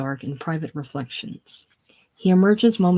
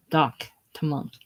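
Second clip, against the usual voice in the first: ordinary delivery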